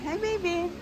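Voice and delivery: high, crooning hi to a pet. Real, not acted. Owner greeting her sore dog, high crooning baby talk.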